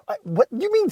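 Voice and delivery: normal talking voice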